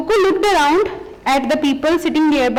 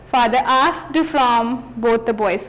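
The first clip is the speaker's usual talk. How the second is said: normal talking voice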